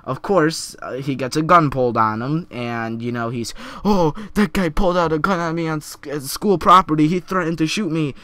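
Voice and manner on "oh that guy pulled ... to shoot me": higher, quick imitation of panicked complaint; hammed-up victim act; mock alarm. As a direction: play up the outrage as a mocking retelling. Acting out the prankster's complaint.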